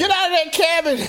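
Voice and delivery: high, loud mock yell. Real, not acted. Co-host yelling at the lonely author, high. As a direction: yell at him to get out.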